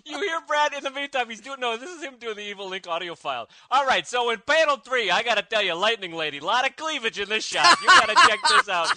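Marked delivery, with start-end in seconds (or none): 3.7-8.9 loud New York plumber type; brash New York voice, laughing; New York accent. Pretend. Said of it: "from New York all of a sudden".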